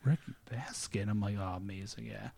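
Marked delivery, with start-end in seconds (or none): 0.0-1.1 very soft, stunned echo of the name; hushed reaction. Retold or imitated. Listener hearing the wrong name at a wedding, hushed. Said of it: "I was like". 1.4-2.3 very soft, low retold relish; hushed delight. Listener savoring the wrong name, hushed.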